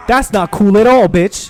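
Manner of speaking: loud mock-indignant yell; loud outburst. Real, not acted. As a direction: shout it at the screen. Reacting loudly to game clip.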